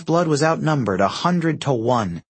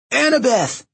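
calling out; 11 semitones higher than usual. A narrator's reading. Percy calls to Annabeth amid the mob, calling out. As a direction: call her name across a battle. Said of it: "Percy called".

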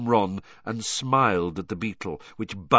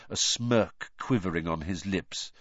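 ordinary delivery, nothing marked

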